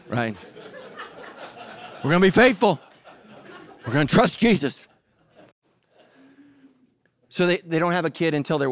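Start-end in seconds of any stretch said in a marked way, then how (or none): 2.0-4.9 laughing pledge. Retold or imitated.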